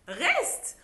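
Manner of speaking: high, loud call after someone; mock call. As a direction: call her back sharply. Calling after leaving bridesmaids. (appel fort et aigu)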